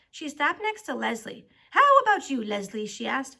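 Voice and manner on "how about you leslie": single out the victim loudly; menacing challenge. A narrator's reading. Teacher rounding on Leslie with the brine, menacing.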